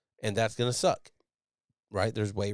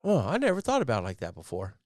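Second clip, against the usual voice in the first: imitated epiphany; 3 semitones higher than usual. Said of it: "they had this epiphany of".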